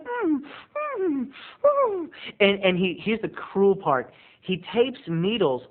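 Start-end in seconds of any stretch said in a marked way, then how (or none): 0.0-2.1 gagged whimpers. Retold or imitated. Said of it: "so she's like".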